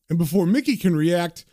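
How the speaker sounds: ordinary delivery, nothing marked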